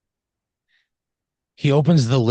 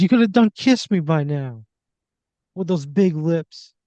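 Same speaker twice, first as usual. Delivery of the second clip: higher, hammed-up tearful plea; mock sobbing woman; 7 semitones higher, 4 dB louder than usual. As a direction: imitate a weepy, rejected woman. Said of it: "She just sobs".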